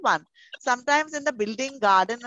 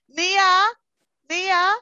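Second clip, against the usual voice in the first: calling out; 7 semitones higher, 5 dB louder than usual. Real, not acted. Calling names for attendance.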